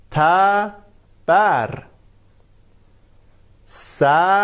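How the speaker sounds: "تبر" drawn out very slowly, each fatha clear, then "سبد"; slow model words. Shown as a demonstration. Teacher models fatha words for the children, slow and clear. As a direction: model each word slowly for the class to copy. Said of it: "به این کلمات دقت کنید".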